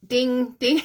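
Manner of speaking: high bright mock ding-ding of a bell; ping it out like a chime instrument; imitated bell ding.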